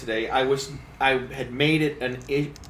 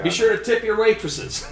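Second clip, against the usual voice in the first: laughing; 9 semitones higher, 4 dB louder than usual. Acted. Comedian's stock line, laughing.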